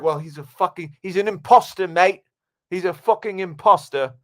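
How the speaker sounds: high, loud mock-British outrage; British accent. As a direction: exclaim like an indignant British viewer. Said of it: "you'd be like".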